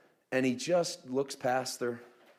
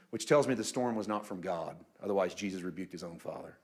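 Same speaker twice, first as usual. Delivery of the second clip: ordinary talk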